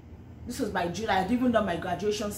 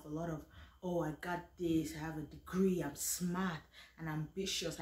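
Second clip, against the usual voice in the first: quiet, much softer preaching reflection; soft sermon; 4 semitones lower, 10 dB quieter than usual. Real, not acted. Speaker sharing her faith lesson with viewers, soft testimony.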